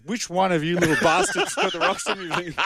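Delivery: half-laughing cheeky accusation; chuckling through a mock-angry question; laughing mock outrage. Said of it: "He goes".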